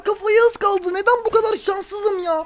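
high-pitched, disbelieving lament; exasperated wail. Real, not acted. Gamer lamenting a missed header, exasperated wail. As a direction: complain high at your own rotten luck.

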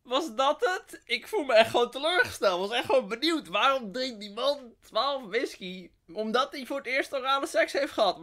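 real indignant letdown after a bad joke; disappointed disbelief. Real, not acted. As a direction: sound openly let down by the punchline. Host let down by the joke.

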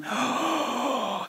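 a soft, high, breathy gasp of awe; mock delighted gasp. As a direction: gasp as if a star walked in. Everyone adoring you entering room.

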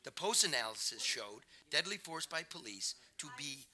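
measured, emphatic book reading; nonfiction narration. A narrator's reading. Author reading a section on deaths by police, emphatic narration. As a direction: read it out firmly, landing the key words.

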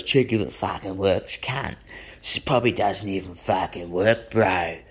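low-voiced mock tough guy; put-on surly heckler voice; thuggish mate. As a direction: imitate a sneering young bloke. Buyer's mate sneers at scooter.

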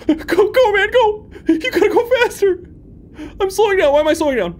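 high, loud frantic shouts; panicked yelling. Real, not acted. Player fleeing a creature, panicked shouting. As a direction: scream at your own character to run. Panicked chase in horror game.

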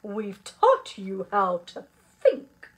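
loud, emphatic teacher voice; rally the class with conviction; hearty, assured pep talk; confident encouragement. Acted. Teacher rallies the worried class.

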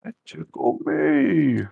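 high, slow teasing imitation; squeaky mimic.

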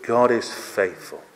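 ordinary talk

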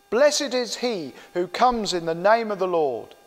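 normal talking voice

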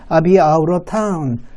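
slow "I'll be out of town", L dropped, "out of" as "ou-ruh"; model pronunciation. Shown as a demonstration. Teacher has students repeat, slow linked "I'll be out of town". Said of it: "발음 따라해보죠".

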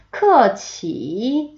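크어치 very slow, each tone stretched out; tone drill. Shown as a demonstration. Teacher starting tone practice, 크어치 slow and drawn out. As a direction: draw out both tones of 크어치. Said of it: "성조 연습 먼저 해볼게요".

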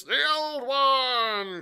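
high, long-drawn shout; crying out. A narrator's reading. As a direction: bellow the request, stretching each word. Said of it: "cried another".

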